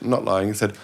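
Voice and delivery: ordinary delivery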